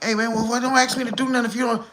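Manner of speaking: high, fast, indignant mock protest; Scar getting mad. Scar snapping back at his friend over the tossed drink, fast indignant mock voice. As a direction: fire it back fast and high like he's offended. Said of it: "And he get mad like".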